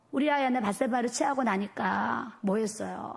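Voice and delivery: usual speaking voice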